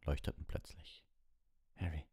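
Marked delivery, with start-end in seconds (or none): 1.8-2.1 croaked. Acted. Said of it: "krächzte er".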